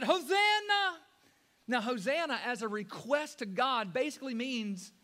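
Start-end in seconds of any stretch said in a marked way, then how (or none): 0.0-1.0 high, loud ringing cry; crowd shout. Retold or imitated. Preacher voicing the crowd, shouting high and loud. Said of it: "They shouted".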